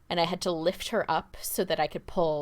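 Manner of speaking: ordinary talk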